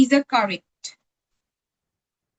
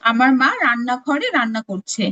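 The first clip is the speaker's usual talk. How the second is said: usual speaking voice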